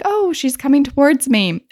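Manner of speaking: mock alarm. Guest alarmed, pitch up.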